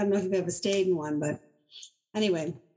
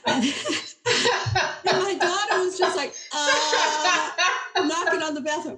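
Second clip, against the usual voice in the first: high laughing narration, slowed; can barely get it out for laughing; real laughter breaking through; 8 semitones higher, 3 dB louder, slower than usual. Real, not acted. Laughing while retelling crib story.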